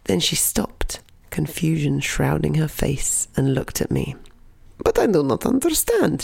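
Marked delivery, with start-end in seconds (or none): 4.8-6.2 high, puzzled Russian granny; lightly voiced confused granny; confused old granny.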